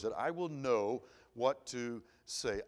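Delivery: drawn out slowly, each word weighed; slow it right down to drive the prayer home; slow emphatic repeat. Retold or imitated. Preacher repeating a friend's prayer, slowly and deliberately.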